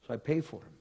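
usual speaking voice

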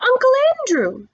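very high, loud exclamation; shouted out. A narrator's reading. Boy suddenly remembering his uncle's name, shouted out. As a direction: cry it out, pitch soaring then falling. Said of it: "And he shouted out".

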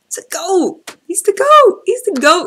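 high, loud excited shout; hyped shout. Real, not acted. Fan hyping the rapper to the crowd, a high excited shout. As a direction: yell it out in pure excitement.

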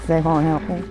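normal talking voice

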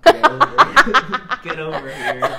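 high, squeaky laughing invitation; laughing mock come-on. Friend mocking the intimate scene, laughing helplessly. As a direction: barely get it out through giggles.

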